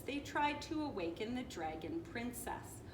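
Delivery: ordinary delivery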